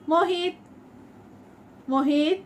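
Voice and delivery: lightly voiced android carer calling the boy; Manasi calling out. A narrator's reading. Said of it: "मानसीचा आवाज बाहेरून आला". (मोहितला हलकेच हाक मारणारी मानसी)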